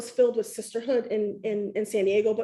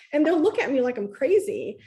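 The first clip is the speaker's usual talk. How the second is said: usual speaking voice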